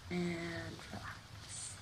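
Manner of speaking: very soft, stretched "and relax"; slow release cue. Real, not acted. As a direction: breathe out "relax" slowly and softly. Cue to release squeezed fist.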